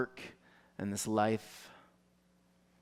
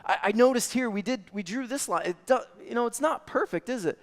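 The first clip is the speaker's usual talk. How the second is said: higher, coaching, gently upbeat; encouraging parent; 9 semitones higher, 6 dB louder, faster than usual. Retold or imitated. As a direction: guide her kindly through her drawing. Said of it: "tell her".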